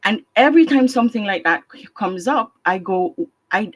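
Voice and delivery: usual speaking voice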